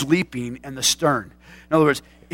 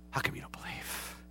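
whisper; 18 dB quieter than usual. Retold or imitated. Jesus rebukes, whispered.

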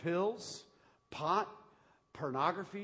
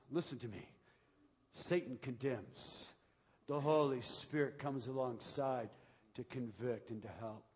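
normal talking voice

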